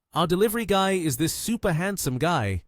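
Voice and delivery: high-pitched excited announcement. A narrator's reading. Girl telling her friend about the handsome courier, excited.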